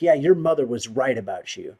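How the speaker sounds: mocking taunt. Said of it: "they'll say things like".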